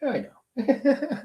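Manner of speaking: high, slow, laughing yeah I know; laughing reply. Retold or imitated. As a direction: let the laugh carry it, drawn out. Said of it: "I was like".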